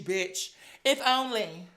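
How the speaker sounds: ordinary delivery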